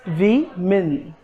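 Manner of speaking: वी मिन slow, syllable by syllable; correct pronunciation. Shown as a demonstration. Teacher repeating the correct word for learners, slow split वी मिन. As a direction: slowly break women into "wi... min". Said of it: "the correct pronunciation is".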